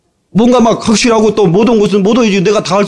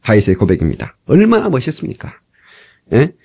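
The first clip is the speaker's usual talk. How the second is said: ordinary delivery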